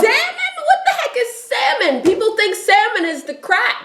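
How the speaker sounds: high, laughing indignation about salmon; mock outrage. Fish snob scoffing at salmon to his friend, high mock outrage. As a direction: play it as a fussy fish snob.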